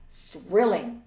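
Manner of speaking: loud, dry sarcasm; deadpan the word with scorn; sarcastic. A narrator's reading. Marjorie's sarcastic verdict.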